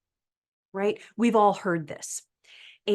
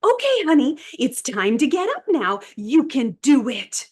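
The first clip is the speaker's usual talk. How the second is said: sing-song elderspeak; 6 semitones higher, 7 dB louder than usual. Retold or imitated. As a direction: talk down to a patient like a toddler. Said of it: "one of the physical therapists say".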